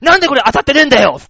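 fast, loud furious shout; retold angry yell. Old man yelling at the counter lady, furious fast shout. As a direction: bark at the clerk in a rage.

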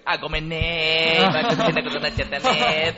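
sweet coaxing voice. Retold or imitated. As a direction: coax it sweetly like a cute puppet. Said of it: "ちょっと甘えた声だ-、出したりとかして".

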